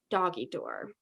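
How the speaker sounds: ordinary talk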